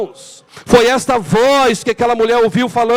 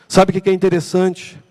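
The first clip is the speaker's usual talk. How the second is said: normal talking voice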